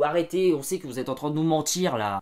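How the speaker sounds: loud mock scolding, arrêtez; calling them out. Real, not acted. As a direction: sellers get called out, loud scolding. Calling out lying Vinted sellers.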